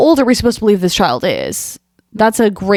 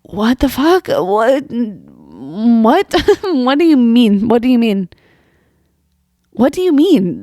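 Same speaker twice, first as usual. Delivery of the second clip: incredulous, pitch jumping up, breaking into a laugh; baffled disbelief; 3 semitones higher, slower than usual. Real, not acted. Commentator floored by an unbelievable gym story, incredulous. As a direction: react utterly baffled, repeating the question.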